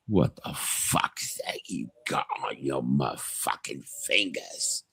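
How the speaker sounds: menacing whispered British tough, slow and low; British accent. Retold or imitated. Man in a pub threatening the goth rocker, whispered British accent. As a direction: hiss it like a Northern pub thug. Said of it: "You're doing a very good British accent".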